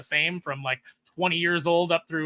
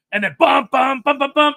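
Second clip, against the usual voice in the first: dramatic music hum; 8 semitones higher, 10 dB louder than usual. Retold or imitated. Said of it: "dramatic music imitated".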